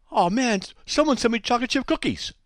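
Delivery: ordinary talk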